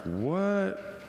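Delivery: high-pitched mock disbelief; incredulous. Listener amazed by a tape about heaven, hammed-up disbelief. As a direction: blurt it high, as if utterly baffled.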